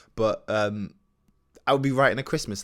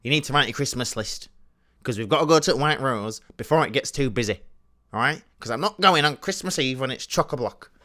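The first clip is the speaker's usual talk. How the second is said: lightly voiced strict mother, higher; strict mum; 4 semitones higher, 5 dB louder than usual. Mum instructing her child about the Christmas list, mock strict. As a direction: imitate a mum laying down the law. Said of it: "my mum was quite strict with it".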